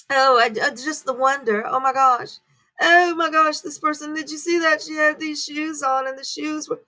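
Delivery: gushing fan. Retold or imitated. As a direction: lightly mimic a tabloid fan swooning. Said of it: "speaking that way about old men".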